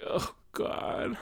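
a hammed-up cringe; mock dread. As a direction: gasp it out like you just heard yourself. Dreading replaying own voicemail.

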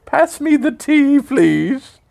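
put-on high, slow posh British old-lady voice; mock an elderly British woman, way up high; old British lady impression. Dad mocking daughter as a high, slow old British lady. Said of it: "But it's like".

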